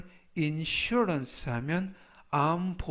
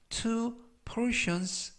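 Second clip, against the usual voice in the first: slow example read; 7 semitones higher, much slower than usual. Shown as a demonstration. Reads example sentence slowly.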